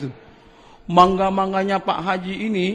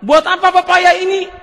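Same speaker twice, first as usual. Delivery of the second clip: louder, higher astonished asking; startled question; 8 semitones higher, 5 dB louder than usual. Retold or imitated. Pak Sahlun startled to see a cart of papayas, exclaiming. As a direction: caught off guard by a cartful of papayas. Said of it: "Kaget Pak Salun".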